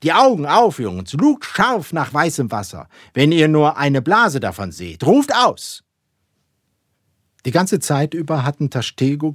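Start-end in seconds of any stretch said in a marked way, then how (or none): 0.0-5.8 loud, high captain's commands; calling orders across the deck; rousing orders. A narrator's reading. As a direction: urge the lookouts on, building to a cry. Captain calling orders to his crew.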